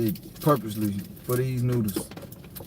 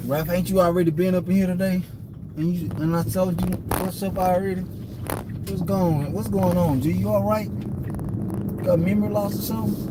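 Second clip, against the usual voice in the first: calling out; 7 semitones higher than usual. Real, not acted. Calling out to a man.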